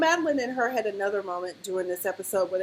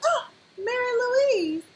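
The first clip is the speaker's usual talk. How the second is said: much higher, slow, drawn-out creepy sweetness; mock sing-song greeting; 13 semitones higher, slower than usual. Mary Louise greeting someone, high slow creepy sing-song. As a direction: coo it slowly like a creepy neighbour.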